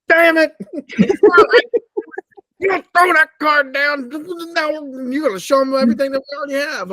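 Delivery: high, mock irate partner; high-pitched imitation of a card partner scolding a bad play; mock Tam scolding. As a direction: squeal it like an annoyed card partner.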